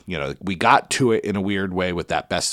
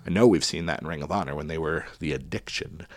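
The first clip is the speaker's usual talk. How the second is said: ordinary delivery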